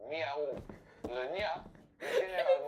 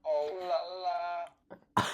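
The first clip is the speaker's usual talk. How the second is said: ordinary talk, nothing marked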